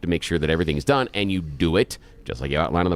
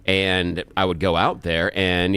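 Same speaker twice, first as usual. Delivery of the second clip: ordinary talk, nothing marked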